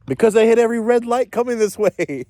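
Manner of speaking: high and loud, fed up; exasperated outburst. Real, not acted. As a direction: blurt it out in disbelief. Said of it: "repeats answer, exasperated".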